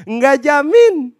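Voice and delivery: loud, high, drawn-out retort; emphatic rebuttal. Acted. Preacher rebuts a praying believer, loud drawn-out emphasis. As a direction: stretch it out and ring it loudly. Emphatic repeat answer to objection.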